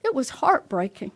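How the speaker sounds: normal talking voice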